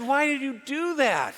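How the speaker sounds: lightly voiced wife, puzzled; wife's voice. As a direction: imitate the wife asking, amused. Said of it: "She said".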